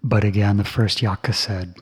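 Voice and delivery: usual speaking voice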